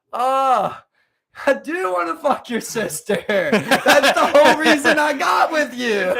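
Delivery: drawn-out, loud mock confession; sarcastic. Joker answering his wife's accusation, loudly sarcastic. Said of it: "You just say it sarcastically".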